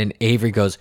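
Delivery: ordinary talk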